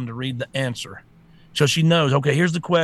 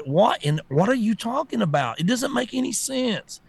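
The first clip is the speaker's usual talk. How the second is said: ordinary delivery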